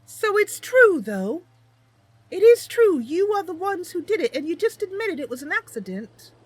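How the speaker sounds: put-on high, earnest young heroine reassuring Mister Thorn; character voice. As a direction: pitch it up and promise eagerly. Player voices her character, accusing NPC.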